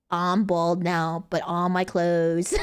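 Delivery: slow, low announcement; slow deadpan. Shown as a demonstration. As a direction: drag it out deadpan. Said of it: "So watch this".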